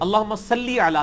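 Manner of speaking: «صلی» slowly as "sal-lee", clear long ee; correct salli. Shown as a demonstration. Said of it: "لام سے لی ہوتا ہے".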